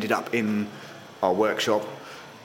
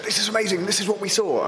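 high, eager retold quote; excited; 9 semitones higher, faster than usual. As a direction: gush about what you just saw. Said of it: "came back to London and said".

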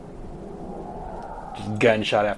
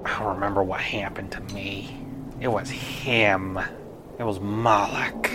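haunted whisper, barely there; eerie whisper; 3 semitones lower, 8 dB quieter than usual. Acted. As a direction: breathe the memory like a ghost. Ghost recalls its death.

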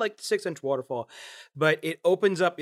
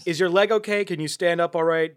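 ordinary talk, nothing marked

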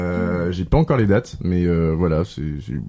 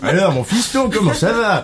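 high, hammed-up fatherly greeting; mock old-cinema dad calling his boy; old French actor impression; 9 semitones higher than usual. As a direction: play a folksy old film father hailing his son. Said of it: "Avec un air de Bourvil".

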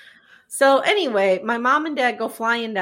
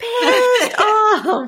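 hammed-up emphasis; 10 semitones higher, 4 dB louder than usual. Real, not acted. Hammed-up telling of Charles's anger.

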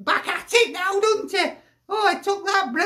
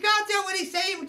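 ordinary delivery

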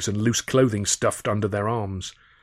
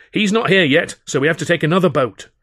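1 raised insistent voice; 7 semitones higher, 6 dB louder than usual. A narrator's reading. Alf insisting on waiting.

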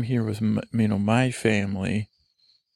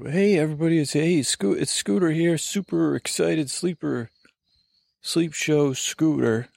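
high, peppy radio-host voice; excitable voice; 5 semitones higher than usual. Acted. Host introducing himself in his excitable voice. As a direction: hype it up like a morning DJ. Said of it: "excitable voice".

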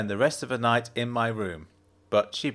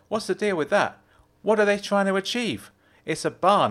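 Jenna's voice; 9 semitones higher, 3 dB louder than usual. A narrator's reading. Jenna's voice in dialogue.

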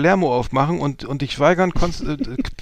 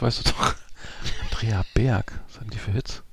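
whispered aside; 5 semitones lower, 7 dB quieter than usual. Real, not acted. Asks aside, hushed.

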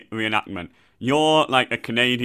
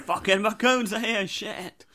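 mock alarm; 8 semitones higher, 4 dB quieter, slower than usual. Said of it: "Is it like".

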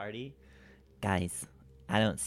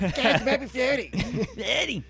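Cartman voice; 14 semitones higher, 6 dB louder than usual. Shown as a demonstration. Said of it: "You sound like Cartman there".

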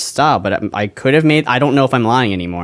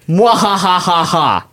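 slow villainous mwahahaha; mad scientist laugh; 6 semitones higher, much slower than usual. Shown as a demonstration. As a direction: draw out an evil mwahahaha. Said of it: "in a Doctor Horrible-like laugh".

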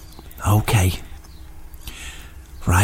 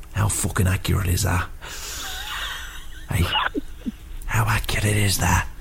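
whisper; 4 semitones lower, 7 dB quieter than usual. Acted. Smug whisper to caller.

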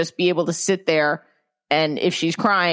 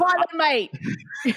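bad British accent; 10 semitones higher than usual. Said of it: "I do a really bad accent".

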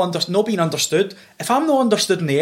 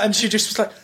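normal talking voice

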